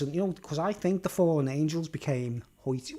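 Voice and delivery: ordinary talk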